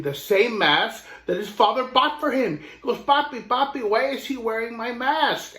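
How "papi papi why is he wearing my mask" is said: put-on higher, puzzled child voice; a boy who can't believe it; surprised little boy. Little boy asks his papa about the mask, high and puzzled.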